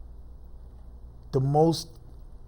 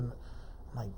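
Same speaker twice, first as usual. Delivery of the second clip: ordinary talk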